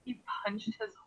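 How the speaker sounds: hushed, wide-eyed disbelief; shocked whisper. Acted. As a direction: whisper it in astonishment. Listener shocked, her eyes go wide.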